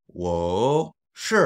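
rising WO, short falling SHI; tone demonstration. Shown as a demonstration. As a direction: let WO climb, then drop SHI. Teacher demonstrates sentence tones.

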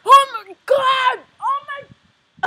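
shocked yell. Real, not acted. Gross-out reaction after tasting.

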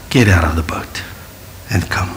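low soft whisper; Jesus quietly calling Peter from the storm; whispered invitation. Retold or imitated. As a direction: breathe the invitation gently.

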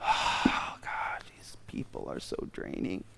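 breathy whispered complaint; exhausted whisper. Retold or imitated. Jesus whispering to disciples, drained and exhausted. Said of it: "he's so exhausted".

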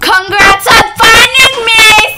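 high, loud taunting shout; playful screaming. Pretend. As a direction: yell it mockingly, climbing into a shriek. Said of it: "I'm screaming".

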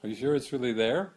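usual speaking voice

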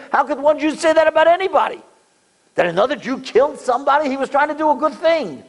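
heartfelt indignant rhetorical questions; indignant outcry. Real, not acted. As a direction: plead against the injustice.

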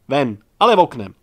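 loud bark of a roar. A narrator's reading. Physics teacher roaring at a pupil, loud. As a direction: roar him out of the class. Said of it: "A Dudek zařval".

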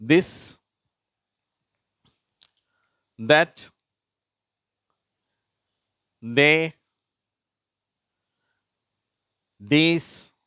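teacher reading a word list; th word demo. Shown as a demonstration. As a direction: model each word with pauses for repeating. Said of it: "அந்த வரிசை".

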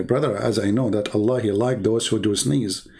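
usual speaking voice

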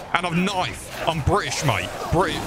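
high-pitched panicky bluff; comic British bravado; mock threat. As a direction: warn off attackers, pitched well up. Bluffing at surrounding enemies.

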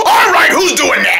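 high, exasperated call; annoyed demand. Acted. Pelted character to unseen thrower, high annoyed yell. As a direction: demand the culprit show up.

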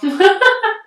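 a loud high squeal of a laugh; sudden loud squealing laugh; high burst of laughter. Real, not acted. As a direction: let out a shrieking giggle. Laughs about her accent.

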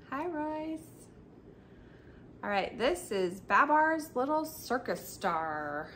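usual speaking voice

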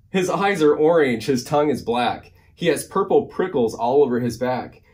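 lively, singsong picture-book reading; animated storytime narration; reading to children. A narrator's reading. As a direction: read the rhyme with big bouncy emphasis. Reads a rhyming picture book.

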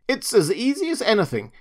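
high, swooping show-off voice; boastful rabbit. A narrator's reading. Furry rabbit showing off his hop, high boast. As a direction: boast breezily, pitched well up.

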